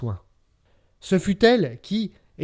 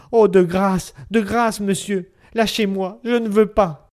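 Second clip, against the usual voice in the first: higher and louder, a frantic plea from a struggling wife; desperate pleading; 4 semitones higher, 3 dB louder than usual. A narrator's reading. Wife clinging to the furniture begging to stay, desperate pleading. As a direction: beg him to let go, rising to a cry.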